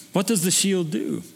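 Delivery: usual speaking voice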